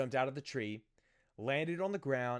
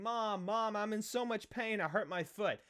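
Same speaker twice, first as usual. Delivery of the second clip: retold crying scream; 7 semitones higher than usual. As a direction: imitate a hurt child calling for mom. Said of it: "was crying and screaming, saying".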